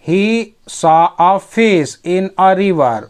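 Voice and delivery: very slow, word-by-word English; slow dictation pace; slow reading. Shown as a demonstration. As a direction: read each word out slowly for learners. Teacher reads story line aloud.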